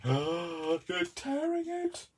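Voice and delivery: very high, slow squeal of shock; mock horror. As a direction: imitate scandalised viewers, high-pitched and drawn out. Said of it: "you're all going".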